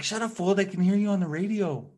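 high-pitched mock telling-off; imitated scolding girlfriend. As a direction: retell her scolding in a high voice. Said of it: "She's like".

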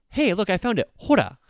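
high, loud Hey look I found it; excited find. Shown as a demonstration. Showing learners how to say hora on finding something, excited. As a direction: call out proudly at a find. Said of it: "use it to be like".

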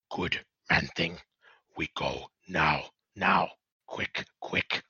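low creature rasp breaking into whisper; low, hoarse Skaven commands; raspy Skaven voice. Acted. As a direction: rasp out the orders like a rat creature. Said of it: "raspy voice".

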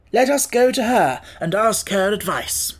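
high, loud, eager figure voice; confident Scarecrow. Acted. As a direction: announce the plan with assurance.

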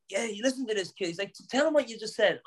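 egging him on in a high voice; imitating hyped Joe. Joe hyping him to Dana.

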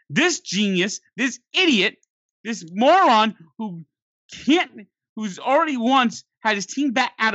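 getting heated at the manager; exasperated rant. Real, not acted. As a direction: spit out the insults in disbelief. Ranting about the manager.

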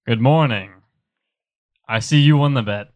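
ordinary talk, nothing marked

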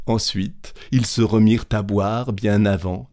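usual speaking voice

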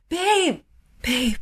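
high, drawn-out call of "babe". Retold or imitated. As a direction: imitate him calling for attention, high-pitched. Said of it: "would call out to me".

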